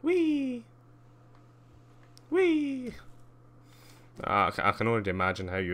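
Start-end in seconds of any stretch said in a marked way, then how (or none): 0.0-0.6 very high, drawn-out whee; playful whee. Real, not acted. Playful cry while flying in game. 2.3-2.9 very high, drawn-out whee; playful whee. Real, not acted. Playful cry while flying in game.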